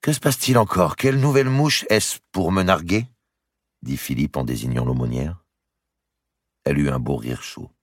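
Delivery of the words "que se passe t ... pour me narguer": jealous retort. Acted.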